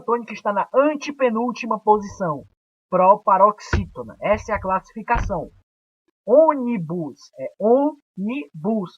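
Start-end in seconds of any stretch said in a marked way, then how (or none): 6.3-9.0 "ônibus é ônibus" slowly, the second split as ô, ni, bus; strong first ô in each "ônibus"; slow, broken-up syllables in "ônibus é ônibus". Shown as a demonstration. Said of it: "a sílaba tônica é o".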